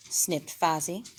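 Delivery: ordinary delivery, nothing marked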